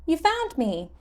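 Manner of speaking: high, delighted little voice; childish glee. Acted. Korok caught by Link, high gleeful child voice. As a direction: crow it like a kid who lost at hide and seek.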